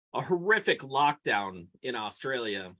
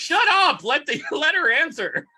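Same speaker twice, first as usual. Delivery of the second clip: high, loud playful yell through laughs; laughing shout; 11 semitones higher, 7 dB louder than usual. Real, not acted. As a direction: cut him off with a laughing shout. Yelling at cohost's joke.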